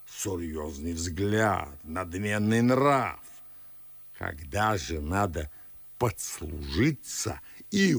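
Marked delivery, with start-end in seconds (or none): 0.1-3.2 slow, very low, solemn pronouncement; pompous low-voiced grandee; haughty gravity. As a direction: sink low and draw out each word pompously. Acting out uncle's haughty look.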